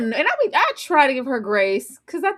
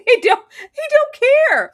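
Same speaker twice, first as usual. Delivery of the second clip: high, loud, laughing delivery; real amusement; 11 semitones higher, 6 dB louder than usual. Real, not acted. Friend recapping his goodbye to her, laughing. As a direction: crack up while saying it.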